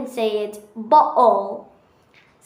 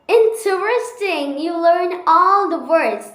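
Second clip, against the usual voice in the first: excited; 6 semitones higher, 4 dB louder than usual. Real, not acted. Excited praise.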